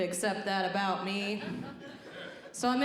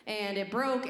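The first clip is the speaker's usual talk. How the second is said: ordinary talk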